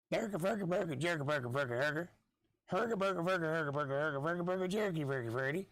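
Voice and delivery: hammed-up Swedish-chef babble, pitched high; mock Swedish gibberish. Podcaster riffing on Swedish speech, in mock Swedish babble. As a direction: bounce the nonsense words in a mock Swedish lilt. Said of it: "talking like this".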